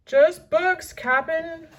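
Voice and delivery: high, loud crewman; crewman's shout. A narrator's reading. Stinky shouting down, high loud crewman. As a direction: yell down to the captain. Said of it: "shouted Stinky".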